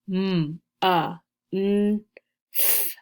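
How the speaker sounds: MONTH in separate sounds "M, a, n, th"; sounded out. Shown as a demonstration. Teacher reviews the sounds in month, sounded out one by one. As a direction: say each sound of "M, a, n, th" apart. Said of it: "count the sounds in the word month".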